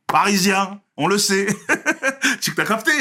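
high, loud amused telling; laughing. Real, not acted. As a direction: crack up while retelling the teasing. Laughs while mimicking Marseillais teasing him.

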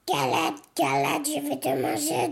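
wolf voice. Acted. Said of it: "dit le loup".

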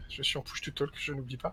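near-silent whisper, almost no voice; whispered aside. Real, not acted. Player whispers a mic reminder to the group, hushed. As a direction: whisper a quick aside about the mic so only the GM hears.

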